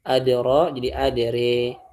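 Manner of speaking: adra said as adere with imala; imalah reading. Shown as a demonstration. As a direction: stretch adra into adere, the a bending toward e. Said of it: "dia dibacanya".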